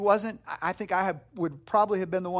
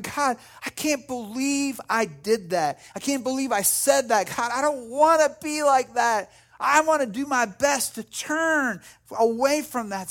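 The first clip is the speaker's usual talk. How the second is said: anguished prayer, higher and louder; broken over sin; 5 semitones higher, 4 dB louder than usual. Acted. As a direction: plead with God in acted remorse. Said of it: "being broken over my sin".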